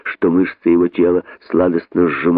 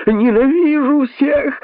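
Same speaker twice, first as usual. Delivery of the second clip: much higher, slow, strained final shout; much higher desperate rabbit scream; last cry; slower than usual. Acted. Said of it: "успел крикнуть находчивый".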